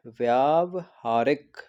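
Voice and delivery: व्यावहारिक very slow, broken as "vyaav – haarik"; syllable-by-syllable model. Shown as a demonstration. Teacher answering own question for students, slow split व्यावहारिक. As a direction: draw out "vyaav", pause, then "haarik". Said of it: "क्या होता है?".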